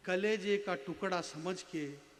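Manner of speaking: low, softened, measured verse; tender poetry recitation. Acted. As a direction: recite slowly to a hushed hall.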